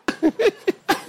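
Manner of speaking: short high laugh. Real, not acted. Laughing at a pastor who won't work, short giggle. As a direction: let out a giggle.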